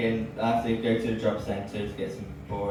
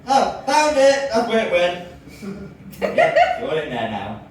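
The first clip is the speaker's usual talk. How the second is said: mock relief; 8 semitones higher, 8 dB louder than usual. Mock relief finding height.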